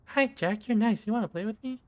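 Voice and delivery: put-on high, sweet little girl doll voice; a doll's squeaky childlike voice; creepy talking doll. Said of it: "the mouth begins to move".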